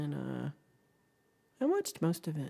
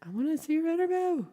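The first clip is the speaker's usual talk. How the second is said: very high, silly put-on pleading; playful high voice; 12 semitones higher, 4 dB louder, faster than usual. Streamer begging viewer to share the interview, playful high voice. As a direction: squeak it out as a playful demand.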